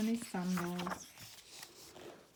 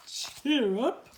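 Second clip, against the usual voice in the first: upbeat, a bit louder; cheerful giant; 2 semitones higher, 6 dB louder than usual. Acted. George encouraging the sad dog, bright. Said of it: "said George".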